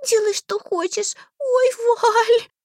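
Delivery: high, loud sobbing wail; crying girl. A narrator's reading. Girl begs Darvald, high loud crying. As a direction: wail it through tears.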